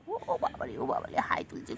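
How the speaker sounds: breathy whisper to a yawning pet; whispered coo. Real, not acted. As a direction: whisper so the sleepy cat isn't disturbed.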